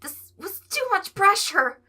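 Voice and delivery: high, loud, slow anxious cry; overwhelmed. Acted. Tweek overwhelmed by everyone's trust, high anxious slow cry. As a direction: wail it as if about to crack.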